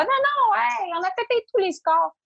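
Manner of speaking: high, bright mock brag; exaggerated put-on bragging voice; fake boasting. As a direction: pretend the launch was a huge hit. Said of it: "je pourrais faire semblant".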